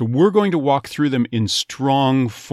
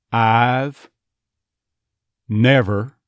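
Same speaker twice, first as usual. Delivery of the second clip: Texas "I've never" with "Ahv" and a hard R; hard bunched R on "never", Texas style; Texas accent; 3 dB louder, much slower than usual. Shown as a demonstration. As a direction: Texas accent demo, a touch louder. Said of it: "Notice that hard R".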